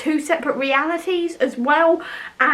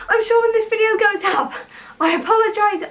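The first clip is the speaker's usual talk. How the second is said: breathless, panting between words; out of breath; 6 semitones higher than usual. Real, not acted. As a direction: talk while still catching your breath. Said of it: "I'm very out of breath already".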